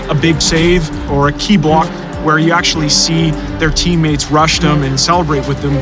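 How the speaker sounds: normal talking voice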